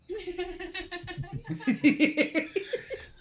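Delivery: a high-pitched burst of real laughter. Real, not acted. Laughing at his own joke about answering the door shirtless, high real laughter. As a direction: crack up high and long.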